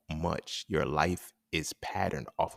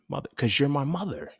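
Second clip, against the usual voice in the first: put-on higher, prim little-kid voice; proper childhood voice; 8 semitones higher than usual. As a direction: say it like a formal little boy. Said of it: "retelling his childhood speech".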